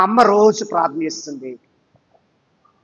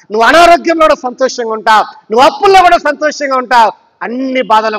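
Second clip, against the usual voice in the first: raised, ringing sermon voice building to a peak; full-force preaching; 4 semitones higher than usual. Acted. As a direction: preach it out over the congregation with rising force. Preaching climax to congregation.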